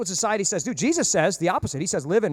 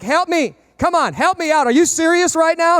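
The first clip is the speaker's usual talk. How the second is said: louder, higher, pleading and fed up; upset customer pressing the useless manager, exasperated; exasperated plea; 9 semitones higher, 6 dB louder, slower than usual. Retold or imitated. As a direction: beg in disbelief.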